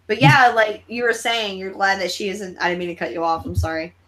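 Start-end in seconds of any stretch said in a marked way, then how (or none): none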